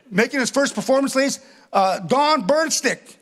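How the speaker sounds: stage introduction. Retold or imitated. Organizer introducing a first-time performer, mock MC announcement. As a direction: announce the act, stretching the name.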